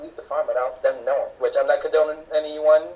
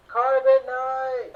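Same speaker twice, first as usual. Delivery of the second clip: outraged exclamation; 11 semitones higher, much slower than usual. Real, not acted. Outraged at Han's fate.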